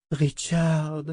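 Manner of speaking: moaning plea. A narrator's reading. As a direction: moan his name pleadingly. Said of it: "gémit sa mère".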